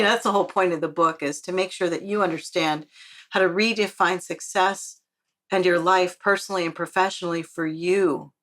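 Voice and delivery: normal talking voice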